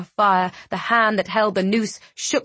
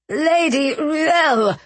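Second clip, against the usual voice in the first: strained high croak; 8 semitones higher, 3 dB louder, much slower than usual. Acted. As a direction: choke the name out. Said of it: "he croaked".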